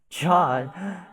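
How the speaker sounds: sudden shock as the name sinks in; shocked gasp of recognition. Acted. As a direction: realise all at once who she means.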